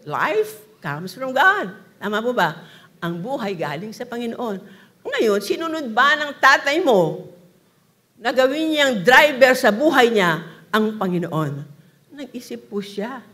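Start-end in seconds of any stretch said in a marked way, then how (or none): 0.0-1.7 emphatic declaration. Acted. Preacher stresses point. 5.1-11.6 loud preaching, each phrase pushed out hard; full-force preaching. Acted. Preacher challenges the congregation, loud and forceful.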